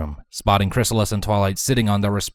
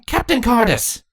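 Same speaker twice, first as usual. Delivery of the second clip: high queen's voice, calling an officer; high, formal address; Chrysalis. A narrator's reading. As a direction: summon the captain as his queen. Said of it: "Chrysalis addressed him".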